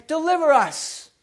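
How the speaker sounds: high pleading cry. Retold or imitated. People cried out to God.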